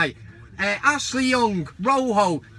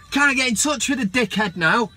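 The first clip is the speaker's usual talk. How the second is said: ordinary delivery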